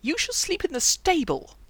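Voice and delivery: high, loud indignant threat; outraged. A narrator's reading. Knight banishing his companion to the stable, outraged. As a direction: pronounce the punishment indignantly.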